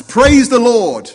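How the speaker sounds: loud, high, drawn-out holler; jubilant shout. Acted. Old lady calling from her front porch, joyful shout. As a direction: call it out slowly to the whole street. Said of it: "and shouts".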